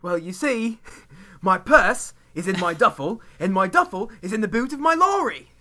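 high-pitched, hammed-up British accent; over-the-top British English, high and insistent; aggressive British accent. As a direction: put on a pushy British accent, pitched well up. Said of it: "my aggressive British accent".

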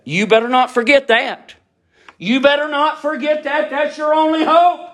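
urgent, high pulpit warning; full-force preaching. Real, not acted. Preacher warning a doubter not to forget, high urgent preaching.

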